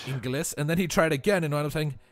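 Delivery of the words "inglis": "Inglis" said with a hard s, no sh sound; typo spelling. Retold or imitated. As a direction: say "Inglis" as spelt, ending in a plain s. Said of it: "No esh, no H".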